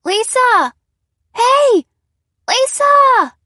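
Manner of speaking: put-on very high, loud child's shout; shrill frightened cries. Harry calls Lisa from upstairs, shrill frightened cries. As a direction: scream for help, shrill and scared. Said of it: "Harry's cries were shrill".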